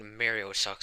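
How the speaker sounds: usual speaking voice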